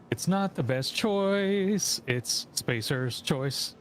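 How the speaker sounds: slow, mopey mascot voice; depressed mascot. Retold or imitated. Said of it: "this dude wearing the mascot who's super depressed".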